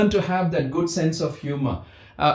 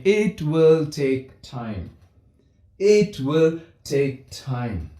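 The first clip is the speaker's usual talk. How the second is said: slow, deliberate reassurance; slow reassuring preacher; slow emphatic; 3 semitones lower, much slower than usual. Shown as a demonstration. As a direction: spell it out slowly. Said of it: "repeated slow emphasis".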